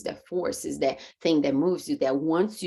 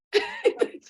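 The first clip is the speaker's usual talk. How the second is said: high disbelieving laugh. Real, not acted.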